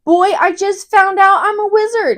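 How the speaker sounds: loud, high mock outrage; hammed-up exasperated kid; indignant protest. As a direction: protest incredulously, rising into loud blahs. Voices Harry's indignant protest.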